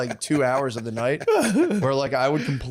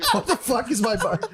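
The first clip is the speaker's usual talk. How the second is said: baffled laughing exclamation; 8 semitones higher than usual. Retold or imitated. Baffled at own toilet.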